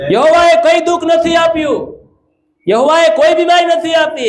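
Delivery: very high, ringing declaration; impassioned high pulpit cry; forceful preaching. Real, not acted. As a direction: proclaim it at the top of your range.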